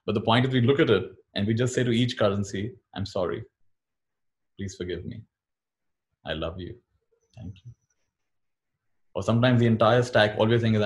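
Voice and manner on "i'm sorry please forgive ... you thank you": softly recited affirmation. Shown as a demonstration. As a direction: say each phrase softly, letting it settle. Reciting practice phrases to money.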